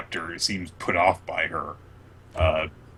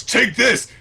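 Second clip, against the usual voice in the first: heroic throw; 14 semitones higher, 5 dB louder than usual. Retold or imitated. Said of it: "picks up the whole thing and goes".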